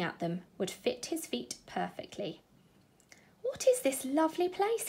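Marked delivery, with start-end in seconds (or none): 3.4-4.9 higher, lightly voiced mouse in wonder; a small mouse musing aloud; wondering little mouse. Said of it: "he wondered".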